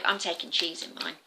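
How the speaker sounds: ordinary delivery, nothing marked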